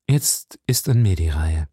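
low, grave voice with restrained emotion; a solemn, understated tremor; trembling. A narrator's reading. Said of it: "selber bebend, wie ein Löwe".

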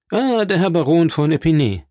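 hoher, lauter Ausruf des Grafen; Graf ruft aus. A narrator's reading. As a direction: ruft den Baron laut und höher an. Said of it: "der Graf ausrief". (Graf begrüßt laut den Baron, hoher Ausruf)

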